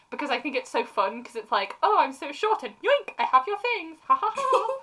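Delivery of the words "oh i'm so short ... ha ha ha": high little mischievous voice with a fake laugh; playful halfling rogue. Halfling rogue snatching someone's things, impish. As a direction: squeak it out like a gleeful small thief.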